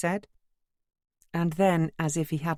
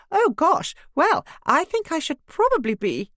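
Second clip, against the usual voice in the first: much higher and louder, flustered; 7 dB louder than usual. Acted. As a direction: fluster and hurry away. Neighbour flees the scuffle.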